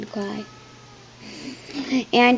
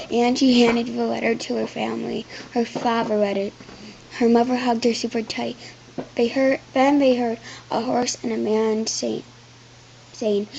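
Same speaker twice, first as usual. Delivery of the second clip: ordinary delivery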